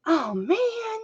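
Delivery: a high cry of dismay; reenacting a moment of dismay; replayed oh man. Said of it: "in that moment when I went".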